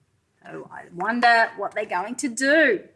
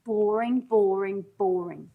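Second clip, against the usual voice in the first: each boring lower and softer, trailing off; fading boredom; 3 semitones lower, 5 dB quieter than usual. A narrator's reading. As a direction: let the boring drain away. Reading dull refrain fading.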